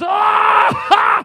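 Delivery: high, loud mock dragon roar. Pastor roaring as the dragon at Bard.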